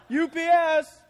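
loud, high playful shout; a playful call across the desert; joking shout. Acted. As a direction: yell it out like a courier announcing himself.

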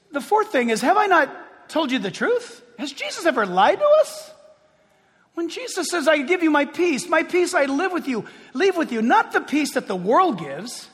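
loud, rising pulpit preaching; preacher pressing his flock. Acted. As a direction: drive it home to the congregation. Preacher building to full force.

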